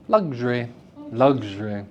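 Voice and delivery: Luxury. squeezed to two syllables, lux-ry; elided luxury. Shown as a demonstration. Teacher demonstrating elision of Luxury. for the class. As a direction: drop the middle syllable of Luxury. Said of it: "can have its middle syllable removed".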